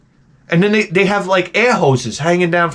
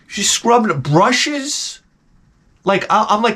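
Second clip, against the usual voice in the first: ordinary talk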